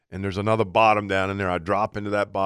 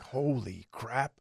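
hushed, slow; 11 dB quieter, much slower than usual. Retold or imitated. Turkey ten feet away.

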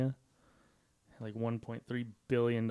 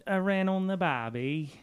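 bad Australian accent that slides into Irish; mock Australian accent; 7 semitones higher, slower than usual. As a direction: do a cartoon Australian, slowing at the end. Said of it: "I can't do any accent".

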